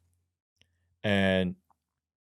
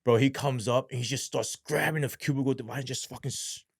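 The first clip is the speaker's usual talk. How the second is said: ordinary talk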